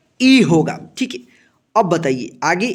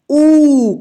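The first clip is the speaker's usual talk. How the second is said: ऊ called louder and higher; called-out answer; 5 semitones higher, 6 dB louder than usual. Shown as a demonstration. Teacher calling out the answer to pupils, raised voice. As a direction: sing out the letter ऊ for the class.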